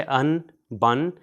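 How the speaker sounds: ان بن said "an", "bun"; slow, clear n endings; ان and بن pronounced with n; tanween sound. Shown as a demonstration. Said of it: "تو یہ تلفظ ہے".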